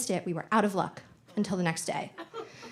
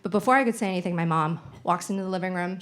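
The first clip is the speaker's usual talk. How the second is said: ordinary talk, nothing marked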